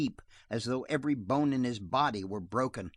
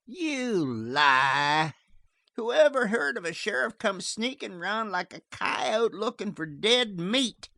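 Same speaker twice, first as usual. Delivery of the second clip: scornful outlaw; 8 semitones higher than usual. A narrator's reading.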